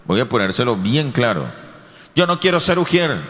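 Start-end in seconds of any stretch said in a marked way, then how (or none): 2.1-3.2 reluctant servant. Acted.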